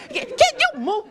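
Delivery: high-pitched joking demand, laugh in it; mock demand. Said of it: "No, I'm just kidding".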